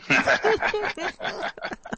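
a breathy laugh at his own joke; high airy laugh. Real, not acted. Host laughing at his own weight-gain joke, airy laugh. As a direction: let out a high unvoiced laugh.

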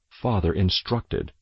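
ordinary delivery, nothing marked

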